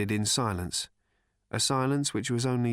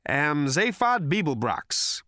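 slow, higher, self-important; grave announcement tossed lightly; 4 semitones higher, 3 dB louder, slower than usual. Acted. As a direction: announce yourself like a big deal. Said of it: "an announcement of such gravity to be tossed in lightly".

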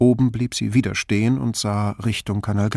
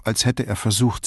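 plain reading voice

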